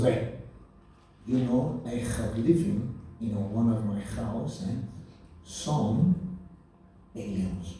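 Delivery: put-on low, halting Spanish-accented English; father's Spanish accent. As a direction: imitate a Spanish dad, slow and hesitant. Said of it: "he goes like this the other day".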